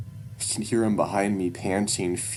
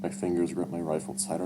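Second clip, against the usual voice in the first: ordinary delivery, nothing marked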